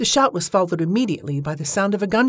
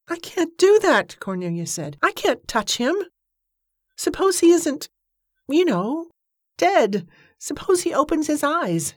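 frightened; 10 semitones higher than usual. A narrator's reading. Frightened refusal.

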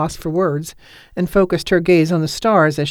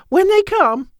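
soaring climax; 4 dB louder, slower than usual. A narrator's reading. Repeated higher.

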